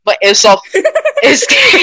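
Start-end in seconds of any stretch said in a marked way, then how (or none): 0.7-1.8 laughing, high and breathless. Real, not acted. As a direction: giggle through the words. Laughs after forgetting prayer.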